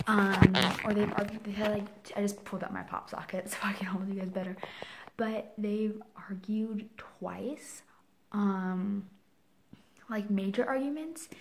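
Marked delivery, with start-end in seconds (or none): 1.4-7.8 voiceless, hurried whisper. Real, not acted. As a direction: keep it barely audible.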